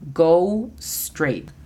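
"Go straight" with gou glide and ei in straight; English go straight. Shown as a demonstration. Teacher models "Go straight" for learners, careful English. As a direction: say "Go straight" slowly, English vowels. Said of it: "La pronunciación fonética es G O U S T R E I T".

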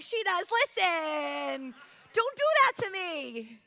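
loud, high, hammed-up protest; mock indignant outburst. Daughter calls mom out in church, mock loud outburst. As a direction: call it out high and loud in joking outrage. Joking outburst over swear jar callout.

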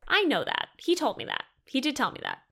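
high-pitched exasperated retort. Real, not acted. Exasperated retort to ex's excuse.

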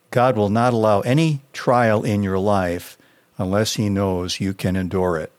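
ordinary delivery

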